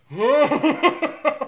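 trembling, laughing, slow; shaking from the heat; shaky voice. Real, not acted. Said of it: "I'm actually starting to shake".

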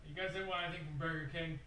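calling out from the doorway; quick call from a distance; far off. Pretend. Called from far away.

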